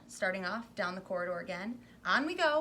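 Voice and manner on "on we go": high, loud cheerful push; brisk urging. Acted. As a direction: move them on eagerly. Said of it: "Wonka hurrying the group".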